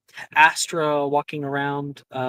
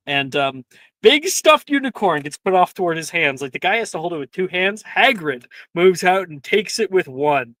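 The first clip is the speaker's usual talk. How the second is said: ordinary delivery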